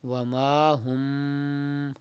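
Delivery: Wa ma hum slow, the mim hummed nasally; slow recitation of Wa ma hum; ikhfa on mim. Shown as a demonstration. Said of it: "Like this".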